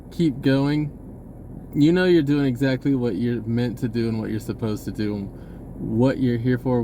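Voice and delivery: ordinary talk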